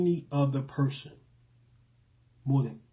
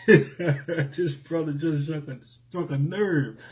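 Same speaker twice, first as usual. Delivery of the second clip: laughing, moved; 3 semitones higher, 4 dB louder, slower than usual. Real, not acted. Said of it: "I'm about to get emotional".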